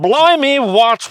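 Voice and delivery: high, loud, hammed-up British accent; a cartoonish cockney yelp. Trying the British line again, mock cockney burst. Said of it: "Try that one".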